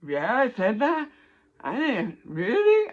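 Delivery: rising to a high squeak on really; hearing her own chart read back, mock disbelief; baffled surprise. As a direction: play it incredulous, disbelieving. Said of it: "I'm sitting there going".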